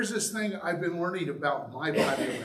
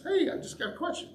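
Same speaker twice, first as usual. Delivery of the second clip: light friendly ask; 12 semitones higher, faster than usual. Shown as a demonstration. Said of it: "That's not what I".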